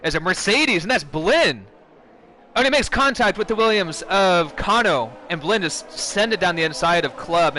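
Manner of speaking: loud, high, excited race call; motorsport commentator. Real, not acted. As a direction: call the contact as it happens. Live call of restart incidents.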